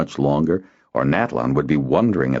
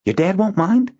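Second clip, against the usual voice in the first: high, hopeful question; hope it's true; 3 dB louder than usual. Acted. Boy hoping to help with the watchwhere, hopeful. Zinar voiced.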